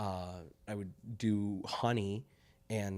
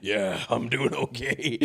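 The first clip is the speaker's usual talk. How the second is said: smoker's rasp; 2 semitones higher, 3 dB louder, slower than usual. Shown as a demonstration. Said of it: "I sound like I smoked a pack a day".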